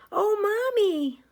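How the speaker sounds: put-on high, loud, slow child's cry of alarm; little girl crying out her lost bear; upset exclaim. A narrator's reading. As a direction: cry out for Mommy in distress. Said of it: "she exclaimed".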